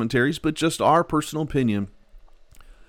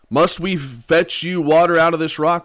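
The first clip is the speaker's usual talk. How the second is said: angry retort; 5 semitones higher, 6 dB louder than usual. Retold or imitated. Moses' angry words quoted.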